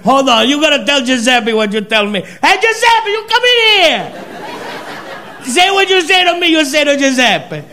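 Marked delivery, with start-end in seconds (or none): 2.4-7.6 Italian waiter calling. Retold or imitated. Waiter calling Giuseppe over in Italian accent, much higher. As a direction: call across the kitchen, overjoyed.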